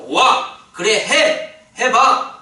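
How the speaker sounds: loud, high go-ahead; eager permission. Retold or imitated. Preacher voicing Jesus letting Peter come, loud and eager. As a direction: bark out a delighted yes.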